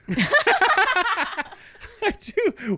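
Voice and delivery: very high laughing squeal. Real, not acted. Host laughing at his cohost's cup, laughing. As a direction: laugh through the words.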